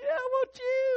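snatch at temptation with glee; greedy grab. Pretend. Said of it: "you grab it and say".

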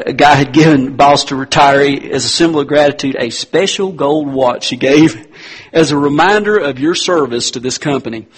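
ordinary delivery

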